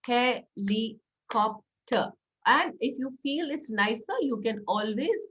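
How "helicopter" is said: very slow, syllables split; syllable claps. Shown as a demonstration. Teacher clapping syllables, very slow. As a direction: stretch out each syllable. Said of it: "four syllables".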